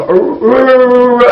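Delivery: mock speaking in tongues with a rapid tongue roll; trilled nonsense syllables imitating fake tongues; imitated tongues babble. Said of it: "你把舌头顶住天堂，发一个音".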